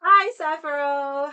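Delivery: loud, high, drawn-out hello; excited greeting. Real, not acted. Streamer welcoming a returning viewer, loud excited greeting. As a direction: stretch the greeting out, loud and delighted.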